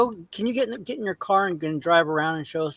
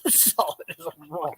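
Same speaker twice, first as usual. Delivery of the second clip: laughing; 5 semitones higher, 7 dB quieter than usual. Real, not acted. Laughing echo of dad.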